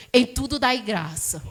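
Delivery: imitating her father. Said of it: "ele dizia".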